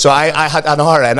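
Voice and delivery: ordinary delivery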